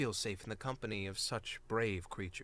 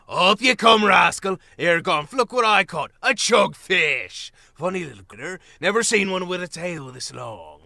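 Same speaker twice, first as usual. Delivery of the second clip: much louder, laughing rescuer calling out; jolly shout; 6 semitones higher, 12 dB louder than usual. Acted. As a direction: tease the soaked little one. Rescuer joking loudly.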